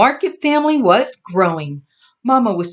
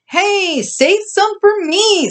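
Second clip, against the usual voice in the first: eager call; 12 semitones higher, slower than usual. A narrator's reading. Skeeter running in, calling.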